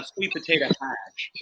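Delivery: ordinary delivery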